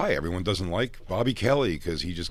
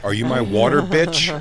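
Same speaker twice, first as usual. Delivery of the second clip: bratty star demand; 4 semitones higher, 5 dB louder than usual. Retold or imitated. Acting out a rude star.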